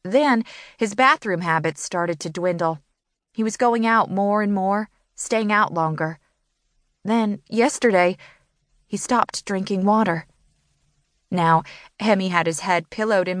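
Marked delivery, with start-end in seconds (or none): none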